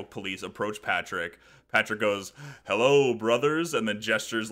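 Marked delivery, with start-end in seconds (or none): 2.7-3.7 slow, louder smug hello; slow self-satisfied hello; smug greeting. Retold or imitated. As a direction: greet them like a fellow officer, smugly. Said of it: "gestures smugly".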